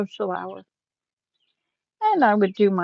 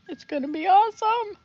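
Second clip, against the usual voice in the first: laughing; 11 semitones higher than usual. Real, not acted. Joking about teeth after death.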